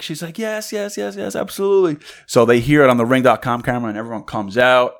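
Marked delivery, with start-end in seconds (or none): 0.4-2.0 high, soft rush of yeses; acted giddy agreement; imitated ecstatic. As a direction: play her overjoyed acceptance, pitched up. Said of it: "So she's ecstatic".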